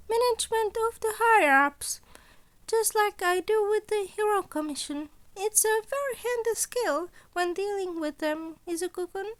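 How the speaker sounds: put-on sly, amused old mentor toying with his student; Nezu voice.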